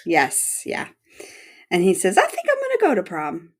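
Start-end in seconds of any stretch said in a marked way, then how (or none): 2.2-3.5 much higher, quick, lightly voiced Nick; imitating Nick. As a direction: pitch Nick's line up high and quick. Imitating Nick telling Charlie.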